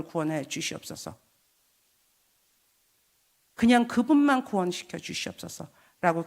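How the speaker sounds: earnest prayer. Retold or imitated. Retelling her prayer aloud.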